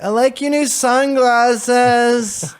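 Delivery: high, very slow dreamy junkie voice; groggy like a zombie after waking; junkie voice echoed. Retold or imitated. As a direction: hungover imitation of the junkie line. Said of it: "That next morning I was like".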